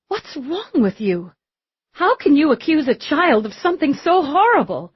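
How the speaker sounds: put-on high, outraged mother defending her child; indignant protest.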